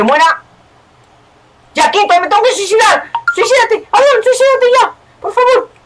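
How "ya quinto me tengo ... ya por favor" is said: very high, squealed pleading; frantic urging. Real, not acted. Player urging teammate to act in game, frantic urging. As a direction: beg them in a high, panicky voice.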